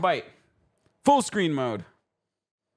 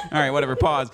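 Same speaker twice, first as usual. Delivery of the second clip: ordinary talk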